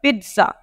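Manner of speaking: पिज़्ज़ा said peet-sa; the first z in पिज़्ज़ा as t; correct pizza. Shown as a demonstration. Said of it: "ट, और ये वाला ज".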